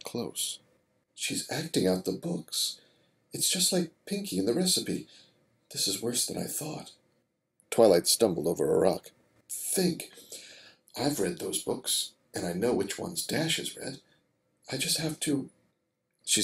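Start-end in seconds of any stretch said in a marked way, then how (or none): 1.1-6.9 thinking aloud to herself; quiet. Acted. Twilight's worried thoughts. 9.5-15.5 quiet. Acted. Twilight's panicked thoughts.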